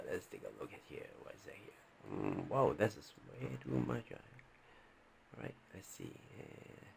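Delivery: muttering to himself. Real, not acted. Teacher searching his files, muttering softly to himself. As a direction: mumble it to yourself as you hunt for the page.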